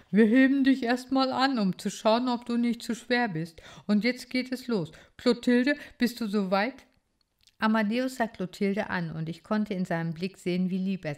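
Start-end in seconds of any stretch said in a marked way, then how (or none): 0.1-6.8 higher, louder cheerful bird voice; a keen titmouse getting ready to fly; eager bird boy. Acted. Amadeus voiced by reader.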